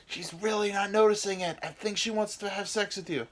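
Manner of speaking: high, eager encouraging voice; penis coach. Acted. His penis assuring him she's into it, high. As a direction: talk him up with conspiratorial glee. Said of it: "and my penis is like".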